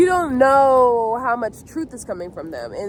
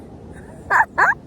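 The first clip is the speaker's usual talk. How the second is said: breathy laugh. Real, not acted. Laughing at a spider while filming.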